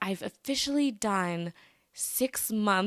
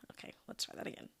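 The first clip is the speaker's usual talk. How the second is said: breathy whisper to herself, off-mic; whispered aside; 18 dB quieter, much faster than usual. Real, not acted. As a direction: murmur it to yourself before retaking. Unvoiced aside before restarting intro.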